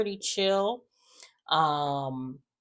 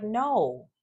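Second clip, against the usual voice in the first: ordinary talk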